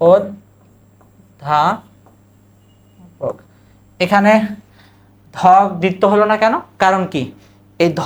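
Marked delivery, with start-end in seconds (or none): 0.1-1.8 অধ্যাপক stretched very slowly, rising; slow teacher demo; slow pronunciation demo. Shown as a demonstration. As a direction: draw out অধ্যাপক sound by sound.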